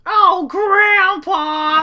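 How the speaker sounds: put-on kid voice. Kid cries out in sketch.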